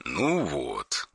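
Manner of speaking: soft, cold menace; quiet threatening caller on the phone; dry harsh voice. Acted. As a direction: threaten without raising the voice.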